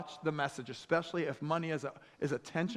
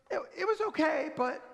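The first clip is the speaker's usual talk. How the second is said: hesitant dream wife; 9 semitones higher than usual. Acted. Dream wife hints at problem.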